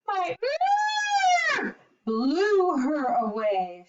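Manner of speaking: trumpeting start, then a mild boast; elephant's mild shout. Acted. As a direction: call out like a bragging elephant. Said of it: "shouted the elephant".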